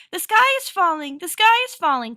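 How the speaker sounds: loud, high panicky shout; clucked alarm. A narrator's reading. Hen drowning out the narrator's warning, clucking in alarm. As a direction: cut in with a frantic hen's cry. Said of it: "clucked Chicken Little".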